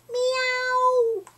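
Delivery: high meow; cat meow. A narrator's reading. The cat calls from the cellar with a high meow. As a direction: mew like a cat.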